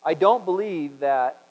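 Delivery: ordinary talk, nothing marked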